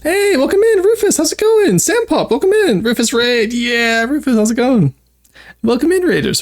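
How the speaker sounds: high bright VTuber greeting voice; chirpy streamer welcome; cute anime streamer. Acted.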